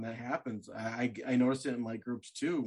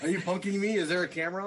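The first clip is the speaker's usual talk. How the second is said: higher, louder, incredulous questions; mock disbelief; 7 semitones higher, 6 dB louder than usual. Host voicing the man at the bar, in mock disbelief. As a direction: play the stunned guy who suspects a prank.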